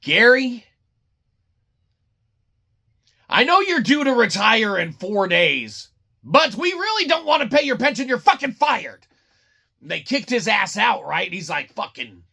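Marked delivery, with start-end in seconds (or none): 0.0-9.1 mock boss firing. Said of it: "They're like".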